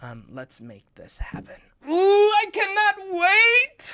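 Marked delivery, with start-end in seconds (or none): 1.9-3.9 very high, loud, drawn-out squeal; overexcited. Pretend. Host who cannot wait for the show, overexcited. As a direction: squeal it out as if excitement took over. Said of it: "I got too excited".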